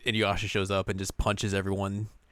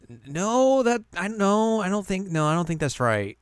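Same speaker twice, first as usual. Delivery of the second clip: high, stammering denial, tripping over every word; a caught-out girl stammering no; flustered Kagome; 10 semitones higher, 3 dB louder than usual. Retold or imitated. Kagome flustered and stammering at the infant's taunts. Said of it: "and Kagome's like".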